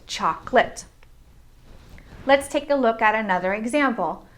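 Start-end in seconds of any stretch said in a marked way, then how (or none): 0.1-0.8 "chocolate" as CHOK-lit; "chocolate" squeezed into two beats; two syllables. Shown as a demonstration. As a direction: say "chocolate" with no middle vowel. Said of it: "it's only two syllables".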